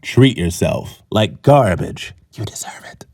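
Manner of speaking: low, slow announcer dropping to a whisper; mock ad slogan. Comedian voicing an airline's mock slogan, low and slow. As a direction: deliver a sleazy slow tagline, ending whispered.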